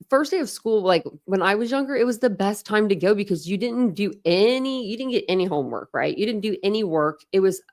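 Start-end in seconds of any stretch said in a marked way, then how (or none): none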